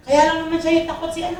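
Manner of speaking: ordinary talk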